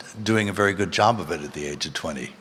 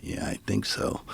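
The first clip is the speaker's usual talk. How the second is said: whisper; 9 dB quieter than usual. Retold or imitated. Dazed reply in retold scene.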